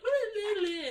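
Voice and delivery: high mock hooting from the back; playful high woo woo; Ron hooting. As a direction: hoot it like an excited kid. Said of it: "Ron's just like".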